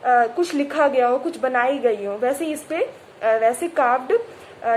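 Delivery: ordinary delivery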